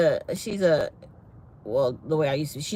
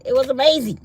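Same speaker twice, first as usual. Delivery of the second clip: loud, higher-pitched burst of praise; emphatic rave; 6 semitones higher, 7 dB louder, faster than usual. Real, not acted. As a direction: belt out AMAZING with excitement.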